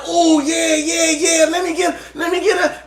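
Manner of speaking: high, loud, thrilled cashier; excited cashier. Retold or imitated. Cashier pulling up the channel, high excited shout. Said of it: "She was like".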